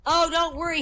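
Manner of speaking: high mock boy voice; little schoolboy. Boy reassuring his teacher, high little-boy voice. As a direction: reassure her in a little boy's high pitch. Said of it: "he says".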